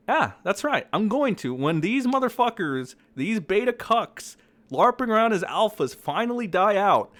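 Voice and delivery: high, loud excited rant. Real, not acted. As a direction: gloat with rising glee. Excited ranting about dancing.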